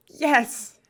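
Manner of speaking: high, loud little yes; a burst of fan excitement; excited cheer. Real, not acted. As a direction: celebrate it. Cheering Cub finally appeared.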